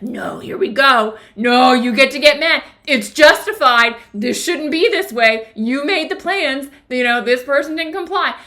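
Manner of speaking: indignant inner voice. Retold or imitated. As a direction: play the self-righteous anger pushing back. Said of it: "this thing in me was like".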